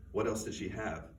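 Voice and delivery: ordinary delivery, nothing marked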